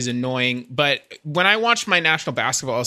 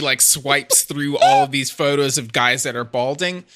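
normal talking voice

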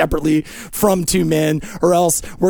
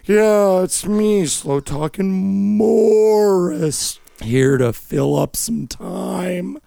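very slow, drawn-out character voice, soft and sinking low; ponderous low, quiet drawl; slow talker; 8 semitones lower, 4 dB quieter, much slower than usual. Acted. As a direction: drag every word out, stretching the vowels. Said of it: "is you slow talking Peter".

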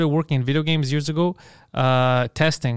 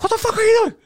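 high-pitched, frantic re-enacted yell; yelling, going crazy; 3 dB louder, faster than usual. Retelling how he lost it at his coworker, yelling like crazy. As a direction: lose it in a squealing, incredulous rant. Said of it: "I remember yelling, going crazy".

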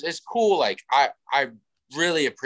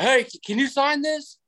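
much higher mock fan voice calling; pestering fan; 13 semitones higher, slower than usual. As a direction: beg for the autograph in a high voice. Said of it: "He's like".

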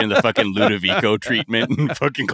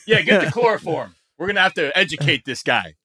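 mock sinister shop worker; 7 semitones higher than usual. Voicing froyo staff kidnapping tourist.

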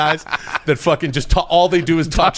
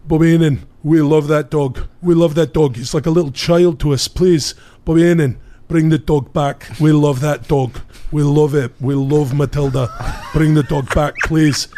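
put-on Davey Boy Smith pleading; 2 semitones higher than usual. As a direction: beg for the dog like a stiff wrestler. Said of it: "Davey Boy Smith's like".

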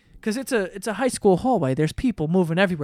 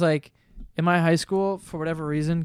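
usual speaking voice

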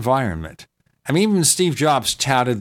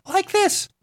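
very high squeaky voice; like you inhaled helium. Shown as a demonstration. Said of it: "That's my Helium voice".

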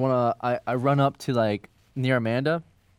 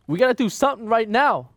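higher-pitched, pressing urgency; urgent pleading; 6 semitones higher, 3 dB louder than usual. Acted. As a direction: push for action as friends are dying. Player urging rescue of dying friends.

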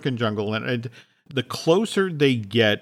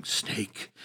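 a frozen, breathless whisper; hushed whisper of fear; 4 semitones lower, 13 dB quieter than usual. Retold or imitated. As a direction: breathe the word out in dread.

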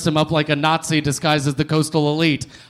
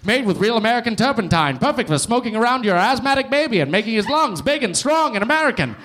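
put-on fast, higher old-time radio announcer; 1940s radiocaster; 6 semitones higher than usual. Comic riffing as a forties radio host, brisk and bright. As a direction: sell it like a wartime newsreel. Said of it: "like a nineteen forties radiocaster".

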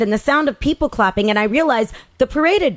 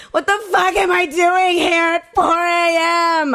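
a higher, slowed, half-sobbing shout; shouting between sobs; 8 semitones higher, slower than usual. Retold or imitated. Woman in the minivan yelling at her girlfriend, retold sob-shout. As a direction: cry it out at her girlfriend, high and dragged. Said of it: "I shouted between sobs".

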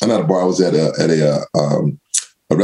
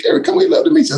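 much higher, upbeat coaxing voice; coaxing owner; faster than usual. Retold or imitated. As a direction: urge a nervous guest along.

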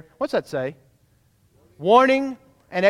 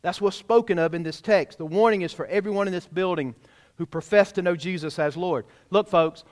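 normal talking voice

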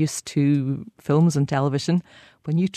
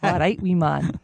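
Glaswegian greeting; 2 semitones higher than usual. Retold or imitated. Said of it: "add on some sort of physical characteristic like".

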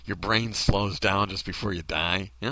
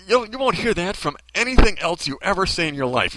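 usual speaking voice

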